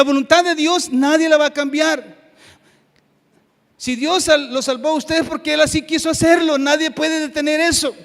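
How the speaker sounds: loud, high-pitched sermon delivery; forceful preaching. Real, not acted. Preacher telling the congregation nobody can stop God, forceful preaching. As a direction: preach it out at full voice.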